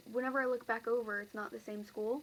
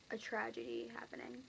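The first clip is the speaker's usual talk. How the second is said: whisper; 6 dB quieter than usual. Real, not acted.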